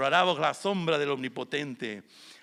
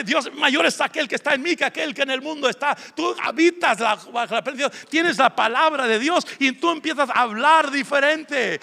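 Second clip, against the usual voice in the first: loud, high-pitched exhortation; impassioned preaching; 7 semitones higher, 9 dB louder than usual. Real, not acted. Preacher urges congregation to change how they speak, impassioned preaching. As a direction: preach it at full force, rallying the room.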